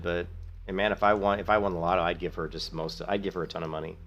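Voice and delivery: ordinary talk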